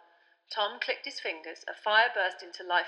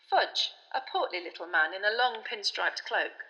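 plain reading voice

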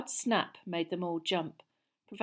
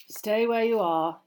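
hoarse warning; 3 semitones higher, 7 dB louder than usual. A narrator's reading. Lupin warns the compartment, slow hoarse command. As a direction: rasp it out, slowly holding them back. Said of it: "in the same hoarse voice".

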